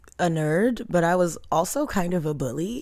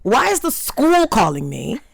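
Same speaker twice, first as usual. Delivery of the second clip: high, startled mock mom; imitating a confused mother; a hint of a loud Haitian mom; mom on the phone; 6 semitones higher, 4 dB louder than usual. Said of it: "who's like".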